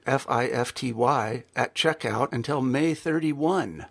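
soft, measured sponsor read. Acted. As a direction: read the promo calmly and clearly. Sponsor ad read before show.

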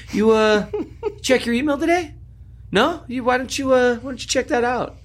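high, teasing sing-song; smug mock question. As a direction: needle the prosecutor with fake innocence. Lawyer springs ruling on prosecutor.

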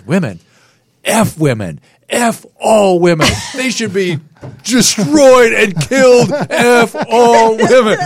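high, loud, slow hammed-up rant; mock Roseanne rant. Retelling Roseanne's hall rant to him, shrill loud mock fury. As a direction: rant at the women like an unhinged Roseanne.

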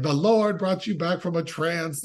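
lightly hammed-up religious declaration; mock pious mother. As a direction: proclaim it in a mock zealous voice. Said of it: "immediately she's like".

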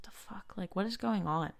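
very soft stunned whisper; a hushed aside to herself, weirded out; whispered disbelief. Retold or imitated. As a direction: breathe it out in baffled disbelief. Stranger asks Coke or Pepsi.

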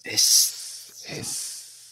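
whispered pantomime snake hiss. Pretend. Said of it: "in a pantomime".

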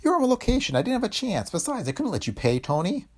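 high character voice, defensive and sorry; apologetic father. A narrator's reading. Father answering Tony's reproach, apologetic.

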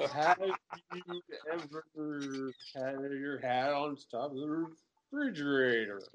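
slow, slurred, dragged-out words; drunk slurring. Retold or imitated. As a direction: slur it like a drunk host on slow playback. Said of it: "sounds like he's drunk and he's slurring".

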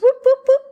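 sudden high, loud whoops; playful sound effect for signing. Retold or imitated. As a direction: sing out quick whoops like a pen flourish. Mimicking quick signatures.

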